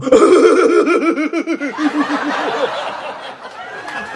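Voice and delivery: loud, high, rapid crazed cackling; berserk mock cackle. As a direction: cackle wildly in long pulsing bursts. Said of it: "she goes insane".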